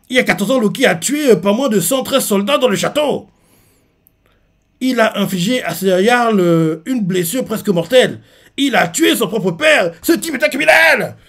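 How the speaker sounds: a hint of an outraged accuser; Aludine accusing. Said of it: "c'est ce que Aludine dit".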